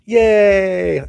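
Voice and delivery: loud, high, drawn-out yay; whooping celebration, high and falling; celebratory cheer. Pretend. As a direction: cheer it out long and loud after a touchdown. Celebrating after the touchdown dance.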